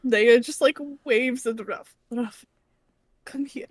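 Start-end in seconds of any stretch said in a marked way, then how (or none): 2.1-3.7 soft, slightly raised beckoning call. Acted. Naya waving Ruff over, soft beckoning. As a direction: quietly coax someone over.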